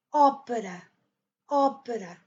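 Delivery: opéra as O-pra, open strong O and weak middle; Portuguese first-syllable stress. Shown as a demonstration. Teacher models Portuguese opéra, stress on O. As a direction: hit the O of opéra, then fall. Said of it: "on dit en portugais".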